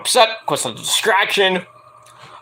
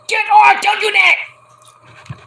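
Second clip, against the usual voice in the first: high, drawn-out mock shout; high-pitched yelling; 4 dB louder, much slower than usual. Recapper imitating a protest during the match, high mock shouting. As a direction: yell it far above your usual voice. Voicing a man reacting at ringside.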